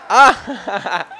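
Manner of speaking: high burst of laughter after a blunder; embarrassed laugh. Real, not acted. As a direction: laugh at your own mistake. Botched play, laughs. (risa apenada)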